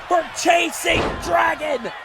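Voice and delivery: fast, high, loud call; excited shout. Real, not acted. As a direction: roar out the big move. Live wrestling play-by-play.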